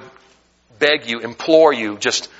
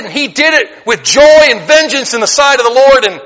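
high and loud preaching, building up; fervent preaching; 9 semitones higher, 6 dB louder than usual. Acted. Preacher warning his church, rising to a loud fervent pitch. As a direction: build it to a pulpit roar. Preaching about wicked kings.

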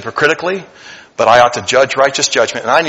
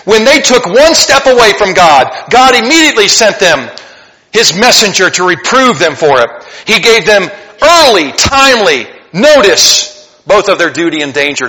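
loud, raised proclamation of the verse; scripture reading; 6 semitones higher, 6 dB louder than usual. Acted. Preacher reading Chronicles aloud to the church, raised and declaiming. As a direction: declaim the passage to the congregation. Quoting Matthew Henry.

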